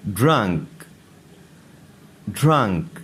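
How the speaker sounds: ordinary delivery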